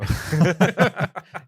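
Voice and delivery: sudden high laugh; real laugh. Real, not acted. Laughs at own joke about age.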